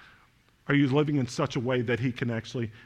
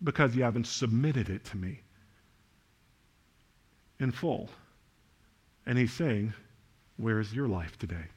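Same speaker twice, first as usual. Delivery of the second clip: much softer and lower, intimate; hushed appeal; 5 semitones lower, 6 dB quieter than usual. Acted. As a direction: lean in and ask it quietly. Soft pastoral appeal.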